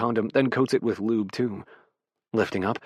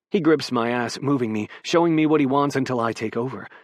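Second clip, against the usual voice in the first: ordinary delivery, nothing marked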